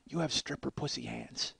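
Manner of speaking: imitating a hushed, very soft accusation; low-volume, confidential delivery; quiet accusation. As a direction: drop the volume right down. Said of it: "but she said it quietly".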